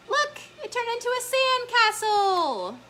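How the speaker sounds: high, gasping show of wonder; gasp, then exclaim way up high; amazed excitement. Pretend. Showing children the sandcastle, high excited wonder.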